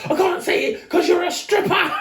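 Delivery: very high mock shout; hammed-up high-pitched reveal, close to laughing; retold outburst. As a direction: blurt the accusation in a squealing high voice. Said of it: "He goes".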